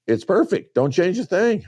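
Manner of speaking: high, singsong parody of the experts; mocking imitation. Mocking the DU guys' verdict.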